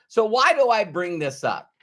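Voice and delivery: ordinary talk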